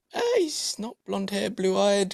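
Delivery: high, soft teasing voice; host joking how the woman picked him out; mock tourist thought. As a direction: lightly voice a shopper sizing him up. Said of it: "You're like".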